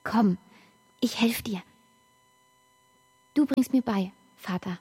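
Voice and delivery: ordinary delivery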